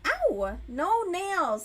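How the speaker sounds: sudden loud high ouch; real yelp. Real, not acted. Scratched by the cat, sudden loud ouch. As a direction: yelp at the cat's claws.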